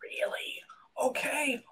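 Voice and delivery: stunned breathy whisper; barely voiced, taken aback. Real, not acted. Whispering in shock at the medium's reading. Hushed reaction to medium's news.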